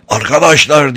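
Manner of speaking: loud call to the room; shouting. A narrator's reading. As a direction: shout it out to everyone. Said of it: "diye bağırdı bilfiil muhtar".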